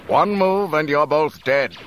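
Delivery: loud, high-pitched threatening order; shouted threat. A narrator's reading. Stormtrooper holding two rebels at gunpoint, shouted threat. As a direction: shout it as an armed warning. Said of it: "shouted a voice".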